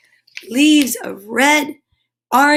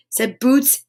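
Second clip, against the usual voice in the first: ordinary delivery, nothing marked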